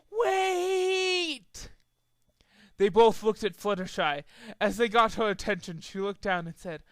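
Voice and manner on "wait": high crying shout; tearful cry. A narrator's reading. Fluttershy stopping them, tearful high cry. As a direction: cry out through tears. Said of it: "sad look ... and yelled".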